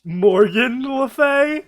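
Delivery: incredulous. Real, not acted. Incredulous at name mixup.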